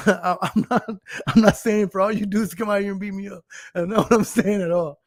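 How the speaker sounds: normal talking voice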